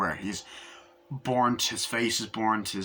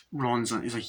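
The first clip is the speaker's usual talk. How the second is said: ordinary talk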